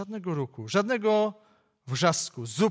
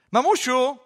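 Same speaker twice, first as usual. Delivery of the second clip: calling out; 5 semitones higher, 5 dB louder than usual. Acted. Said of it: "zawołała dziewczynka".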